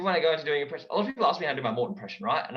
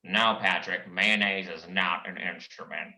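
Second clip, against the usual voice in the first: lower, slow and weary; Squidward voice; 7 semitones lower, slower than usual. Acted. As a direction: drone it out, fed up. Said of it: "is that Squidward?".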